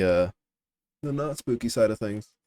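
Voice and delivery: usual speaking voice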